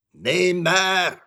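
loud, high raven's croak; harsh bird cry of doom. A narrator's reading. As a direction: croak it out like a raven, drawn out and falling. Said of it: "krächzte da der Rabe".

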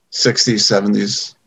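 usual speaking voice

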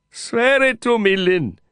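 desperate plea. A narrator's reading. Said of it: "the distress reaching out to me desperately".

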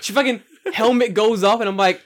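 ordinary talk, nothing marked

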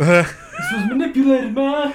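laughing through mock outrage; high and loud, cracking up.

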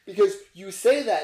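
usual speaking voice

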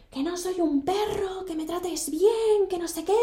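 high, drawn-out imitation of an angry complaint; mock angry yelling. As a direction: mimic his rant in a stretched, high-pitched voice. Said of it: "Él gritaba, gritaba". (queja airada imitada, aguda y alargada)

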